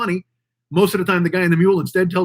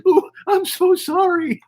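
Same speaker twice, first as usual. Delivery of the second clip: very high, slow mock boo-hoo; hammed-up weepy sorry; fake crying apology; 12 semitones higher, slower than usual. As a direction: sob it out like a caught YouTuber. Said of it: "then they go and apologize".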